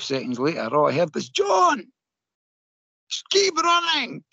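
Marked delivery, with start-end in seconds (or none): 1.3-4.2 high yell from a window far off; a woman hollering after a runner from her flat window; distant shout. Retold or imitated.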